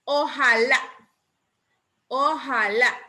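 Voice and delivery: Ojalá with a strong final LÁ; Ojalá measured out, last syllable punched; stress on Ojalá's last syllable. Shown as a demonstration. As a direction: land hard on the LÁ of Ojalá. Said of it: "Lleva acento en la última sílaba".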